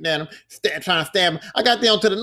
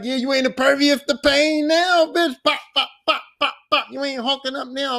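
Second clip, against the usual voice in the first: higher, gloating taunt; taunting gamer; 8 semitones higher than usual. Retold or imitated. Player taunting the hurt vampire daughter, high gloating voice. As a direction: gloat over the wounded enemy.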